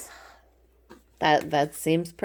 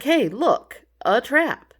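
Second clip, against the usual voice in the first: higher, louder pointing-it-out call; playful higher, louder alert; mock discovery; 8 semitones higher, 7 dB louder, slower than usual. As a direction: sound like you just spotted danger. Card figure voiced spotting trap.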